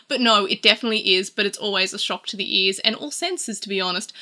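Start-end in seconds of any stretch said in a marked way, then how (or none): none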